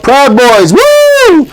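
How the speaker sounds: high-pitched rowdy heckler's whoop; mock cheer, shooting up in pitch; imitated crowd yell. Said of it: "someone yelled".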